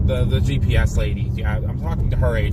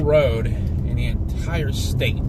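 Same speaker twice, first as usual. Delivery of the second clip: slow sarcastic drawl; slower than usual. Real, not acted. Sarcastic complaint, drawn out.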